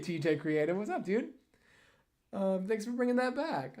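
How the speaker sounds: usual speaking voice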